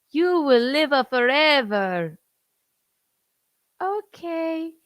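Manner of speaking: high, loud put-on cardinal voice. Son dubs the cardinal blessing the Pope in a silly voice. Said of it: "The cardinal goes".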